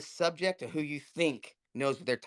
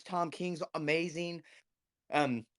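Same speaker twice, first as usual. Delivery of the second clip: ordinary talk, nothing marked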